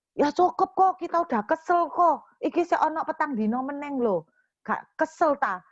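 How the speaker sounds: high, heated comeback to the husband; annoyed retort. Retold or imitated. Wife insists to her husband she is fed up, heated retort. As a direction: snap back in exasperation.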